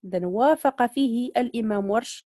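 ordinary talk, nothing marked

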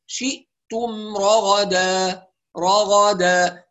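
"رغدا" very slowly, three evenly timed a vowels; complete vowels. Shown as a demonstration. Teacher models for students, complete vowels. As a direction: hold the m of "شئتم", then open each syllable of "رغدا". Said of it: "شوفوا إتمام الحركات".

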